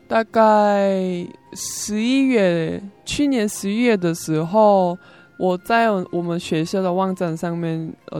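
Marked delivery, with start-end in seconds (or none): none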